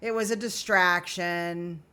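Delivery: slow, drawn-out excuses; slow sing-song list of excuses; mocking excuses. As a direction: drag out the lame excuses. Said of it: "make excuses".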